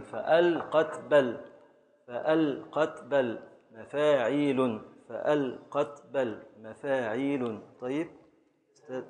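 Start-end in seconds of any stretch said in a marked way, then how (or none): 0.0-7.6 slow, beat by beat chant of فأل قت بل; slow scansion. Shown as a demonstration. Teacher scanning the verse aloud for the class, slow and measured. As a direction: tap out each foot slowly like a metronome. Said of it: "تامة، مفاعيل".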